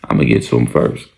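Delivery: very low-pitched, understated threat; retold menacing vow. Daniel vows to strike first, low menacing voice. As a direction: drop the voice right down for the vow.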